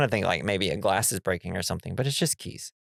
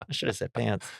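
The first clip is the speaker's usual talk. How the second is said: ordinary talk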